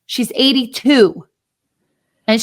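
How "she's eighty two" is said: normal talking voice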